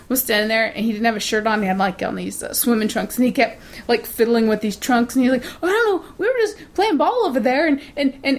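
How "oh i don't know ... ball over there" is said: put-on much higher, fidgety kid voice; nervous little boy. Son fibs to his mother, high and nervous. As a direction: play the squirming kid caught out.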